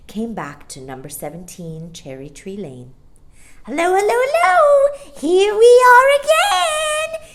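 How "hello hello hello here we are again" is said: joyful screaming starling. A narrator's reading. Starling back from holiday greeting the nursery, joyful high scream. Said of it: "he screamed joyfully".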